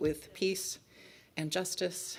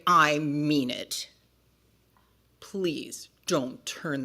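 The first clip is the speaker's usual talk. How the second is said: cross book character; 9 dB louder, slower than usual. Acted. Book character threatening to tell the parents, cross. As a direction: threaten sternly in a lowered voice.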